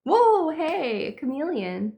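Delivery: high delighted whoa; pleased surprise at the card; surprised. Real, not acted.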